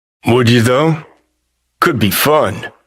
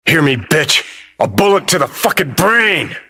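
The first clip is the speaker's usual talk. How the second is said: raised-pitch, furious threat; angry threat; 6 semitones higher than usual. Acted. Gunman threatens someone with a bullet, angry and high-pitched. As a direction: spit out a death threat, voice climbing high.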